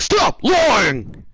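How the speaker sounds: high, loud teacher scream; imitated scream. Teacher confronting a lying student in the hallway, imitated scream.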